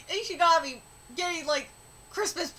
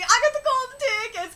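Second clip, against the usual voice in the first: excited quote; 6 semitones higher, 3 dB louder than usual. Retold or imitated. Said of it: "he'd be".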